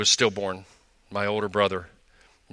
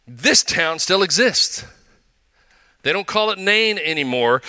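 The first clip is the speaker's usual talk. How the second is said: raised, louder preacher's storytelling voice; fervent preaching; 7 semitones higher, 4 dB louder than usual. Acted. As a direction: preach it out to the congregation with energy. Sermon storytelling, rising energy.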